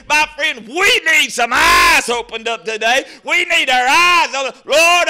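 loud shouted preaching, high cry on Lord; a revival preacher shouting the altar call; full-force preaching. Real, not acted.

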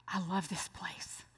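whispered, soft awe; husband whispering his love for the place; whispered delight. Retold or imitated. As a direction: breathe it in wonder. Husband enchanted.

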